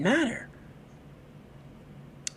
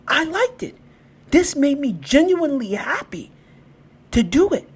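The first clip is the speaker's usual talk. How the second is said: 1 excited; 9 semitones higher, 7 dB louder than usual. Real, not acted. Loud emphatic declaration of joy.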